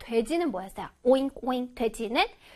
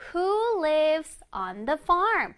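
slow model reading; much slower than usual. Shown as a demonstration. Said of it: "teacher reads the week's title".

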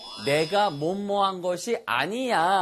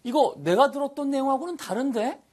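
a lightly acted puzzled customer; 들은 것과 다르다고 살짝 항의하듯; mock complaint; 10 semitones higher, faster than usual. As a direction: play it higher, like a surprised customer. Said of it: "This isn't what I 다음에 지나간 내용".

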